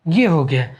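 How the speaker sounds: ordinary talk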